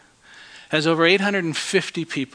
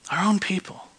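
whispered; 5 dB quieter than usual. Retold or imitated. Husband whispering to the judge about his family. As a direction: whisper 'Our own people' with hushed grief. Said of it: "I just looked at him and I said".

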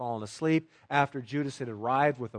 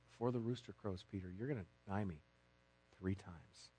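hushed memory; 6 semitones lower, 13 dB quieter than usual. Acted. Jesus' remembered words to Peter.

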